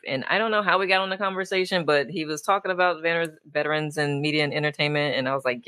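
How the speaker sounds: ordinary delivery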